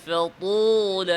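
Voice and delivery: slow chanted tajweed reading of فطول; drawn-out Quranic recitation. Shown as a demonstration. Teacher reciting for students to copy, chanted slowly. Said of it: "বলেন".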